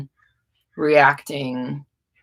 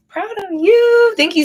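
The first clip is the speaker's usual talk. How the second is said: delighted praise; 5 dB louder, slower than usual. Real, not acted. Praising viewer's message.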